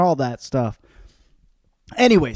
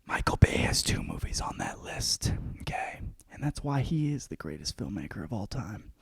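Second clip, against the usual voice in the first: lower, conspiratorial whisper about Michael Bay; mock whisper; 4 semitones lower, 8 dB quieter than usual. Host confides to listeners about Michael Bay, hushed whisper. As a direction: let them in on a secret, hushed.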